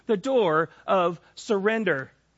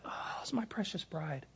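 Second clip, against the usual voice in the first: retold sarcastic whisper, much softer; snippy whisper; 13 dB quieter than usual. Husband retelling how he snipped at his wife, snide whisper. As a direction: hiss it out under your breath, mock sweet. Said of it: "I was snippy, and I was like".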